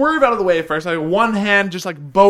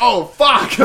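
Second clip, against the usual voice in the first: loud, raised curse edged with laughter; retold alarmed curse; 6 semitones higher, 5 dB louder than usual. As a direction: blurt it out as in mock alarm. Retells moment brakes failed.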